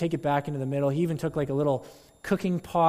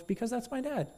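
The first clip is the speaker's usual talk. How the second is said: put-on little boy's answer; 8 semitones higher, 6 dB quieter than usual. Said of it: "he goes".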